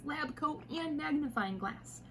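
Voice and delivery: usual speaking voice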